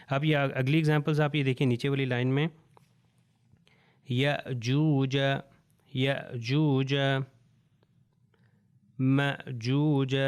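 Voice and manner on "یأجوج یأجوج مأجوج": یأجوج and مأجوج slow, a glottal stop after the first vowel; hamza clearly said. Shown as a demonstration. Teacher drilling یأجوج مأجوج word by word. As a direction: stretch یأجوج with a clean hamza catch. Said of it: "اگر میں کہوں ماجوجا تو غلط".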